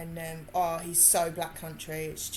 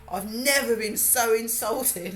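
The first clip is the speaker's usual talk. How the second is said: mock offended Gav; 4 semitones higher, 6 dB louder than usual. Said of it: "he's like".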